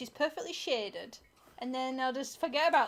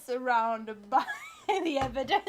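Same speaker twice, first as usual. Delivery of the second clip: louder, laughing through the words; laughing tease; 5 dB louder than usual. Real, not acted. Teasing a friend who denies being drunk, laughing. Teasing drinker about empty shots.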